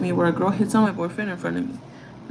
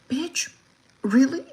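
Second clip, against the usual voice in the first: high, incredulous bitch, really; sharp rising disbelief; outraged disbelief; 6 semitones higher, slower than usual. Real, not acted. Woman reacting to her ex flirting, incredulous.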